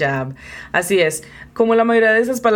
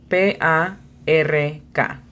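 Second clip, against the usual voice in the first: slow spelling, each letter apart; spelled out slowly; much slower than usual. Shown as a demonstration. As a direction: spell park slowly for the learner. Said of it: "La pronunciación fonética es".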